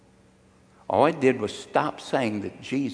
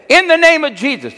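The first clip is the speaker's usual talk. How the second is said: shouted rebuke; 11 dB louder than usual. Retold or imitated. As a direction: imitate a wife's very high, loud command. Retold rebuke of the devil.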